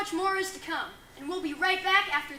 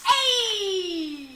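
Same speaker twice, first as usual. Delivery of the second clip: shouting; 3 semitones higher, 9 dB louder than usual. Acted. Shouting the name.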